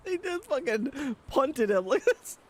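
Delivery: high laughing disbelief. Real, not acted. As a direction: laugh through the words. Laughing at clip.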